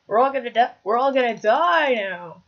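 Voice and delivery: loud, dragging out the final die; mock doom cry. Player announcing the team's doom, loud mock despair. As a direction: wail it out in playful doom.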